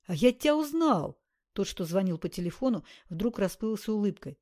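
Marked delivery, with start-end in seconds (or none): 0.0-1.2 радостное узнавание. Acted. Персонаж узнаёт героя.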